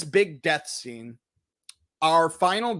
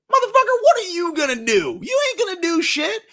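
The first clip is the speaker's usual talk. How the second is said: much higher, louder mocking jeer; loud high sneering taunt at the tough guy; 13 semitones higher, 5 dB louder than usual.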